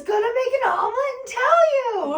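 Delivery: very high, loud crying wail; hammed-up tearful Pepper. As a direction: sob it out in mock despair. Pepper voiced in retold scene.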